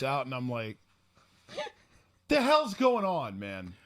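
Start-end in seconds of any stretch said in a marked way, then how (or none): 2.3-3.7 loud, high, drawn-out mock disbelief; retold baffled yell, falling away; bewildered outburst. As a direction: cry it out slowly, then drop on the last word. Acted bewildered outburst in retelling.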